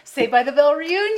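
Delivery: loud, high headline announcer voice; excited announcement. Acted. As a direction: proclaim it like big news. Announcing tabloid cover headline.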